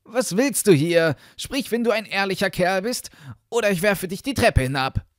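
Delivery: loud, high challenge; calling out. A narrator's reading. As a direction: shout a threat at an intruder. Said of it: "Der Junge rief zum zweiten Mal".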